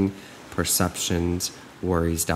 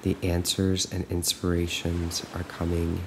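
soft, slow affirmations with long pauses; guided meditation; 8 dB quieter, slower than usual. Acted. Coach leading listeners to repeat trust, softly and slowly. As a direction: let each phrase sink in, quiet and unhurried.

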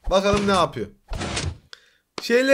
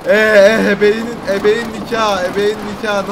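loud, sudden astonished outburst; loud shocked exclamation; stunned; 8 dB louder than usual. Real, not acted. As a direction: blurt out the oath in shock. Amazed by the build.

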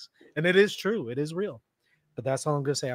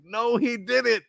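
exasperated retort; 7 semitones higher, slower than usual. Retold or imitated. Retorting to the imagined speaker.

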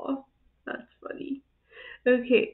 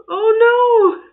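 loud, high-pitched cry of alarm; horrified shout; 10 semitones higher, 12 dB louder than usual. Real, not acted. Watching a fall on video, horrified shout. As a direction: shout out in horror.

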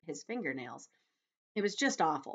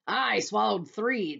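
loud, slow, boastful cry; 5 semitones higher, 7 dB louder, slower than usual. A narrator's reading. Centipede outdoing the others, loud boastful cry. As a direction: top them all loudly. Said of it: "the centipede cried".

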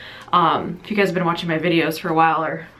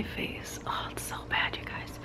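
whisper; 16 dB quieter than usual. Real, not acted. Showing her face at night.

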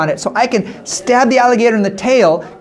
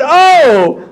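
higher, louder feigned surprise; mock surprise; 7 semitones higher, 3 dB louder than usual. Jose pretends surprise finding the woman hiding, higher and louder. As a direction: act startled to find her. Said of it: "He said".

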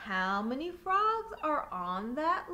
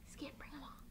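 hushed whisper like a tiptoeing mouse; sneaky whisper; 16 dB quieter, much faster than usual. A narrator's reading. Mouse scampering, hushed narration.

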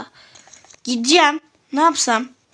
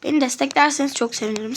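ordinary talk, nothing marked

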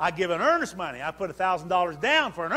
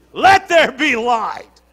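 slow, louder, ringing declaration; passionate proclamation; 6 semitones higher, 13 dB louder, slower than usual. Shown as a demonstration. Preacher showing how God spoke creation, slow passionate proclamation. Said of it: "he said it with some passion".

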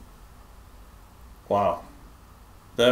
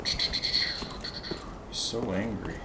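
whisper; 6 dB quieter, much slower than usual. Real, not acted. Boss fight, tense aside.

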